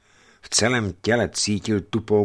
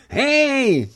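put-on very high, loud boy's shout; piercing child's cry; 6 dB louder than usual. A narrator's reading. Boy alerts the men on deck with a piercing cry. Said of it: "při pronikavém dětském výkřiku".

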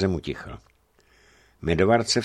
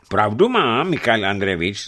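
a loud, higher, vehement call; sharp exclamation; 5 semitones higher, 6 dB louder than usual. A narrator's reading. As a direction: burst in forcefully. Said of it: "zvolal prudce Porfirij Voron". (prudké zvolání)

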